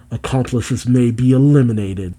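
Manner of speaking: normal talking voice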